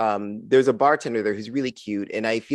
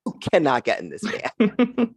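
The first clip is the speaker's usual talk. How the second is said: slow, higher warning to herself; mock alarm; 6 semitones higher than usual. Comic retelling her inner panic at the van, slow and higher. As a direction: tell yourself urgently to back away.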